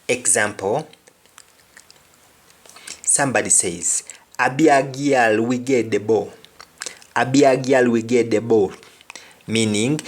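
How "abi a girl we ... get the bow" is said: put-on patois Abi a girl we get the bow; Jamaican patois. Shown as a demonstration. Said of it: "it's only girls we have here".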